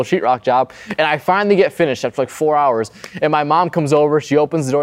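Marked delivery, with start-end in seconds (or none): none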